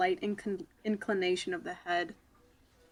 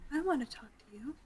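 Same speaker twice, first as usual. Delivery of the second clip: high whispered echo, quick and rising; mock-whisper it back, fast; playful whisper; 6 semitones higher, faster than usual. Player echoes "I wanna talk", playful high whisper.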